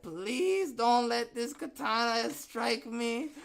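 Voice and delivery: high, slow, frightened plea in a put-on voice; mock scared woman. Host imitating scared woman in scuba suit, high frightened plea. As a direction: ham up a terrified rich lady. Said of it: "she said".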